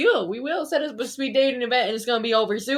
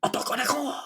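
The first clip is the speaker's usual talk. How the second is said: whisper; 2 semitones higher, faster than usual. Real, not acted. Repeating the word in a whisper.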